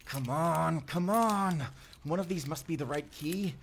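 panicked fumbling with keys, shouting; frantic urgency. Acted. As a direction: hurry before he gets here. Frantic escape through roof hatch.

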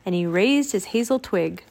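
plain reading voice

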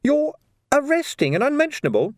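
high-pitched disbelief; incredulous junior squeak; astonished young constable. A narrator's reading. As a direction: question it high, taken aback.

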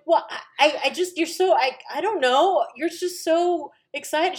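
high, loud, halting stammer; retold flustered reply. Daughter answering excited mom, flustered and stammering. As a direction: stumble through a flustered excuse.

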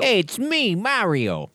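high, bouncy Italian plumber; chirpy cartoon Mario impression. Mario impression, Brooklyn joke.

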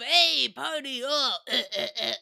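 a hint of a dumb frat bro; frat guy. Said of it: "that kind of".

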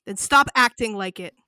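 loud cutting comeback; sharp loud retort. Acted. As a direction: fire it straight back at him. Cobras snaps at V.

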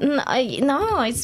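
ordinary talk

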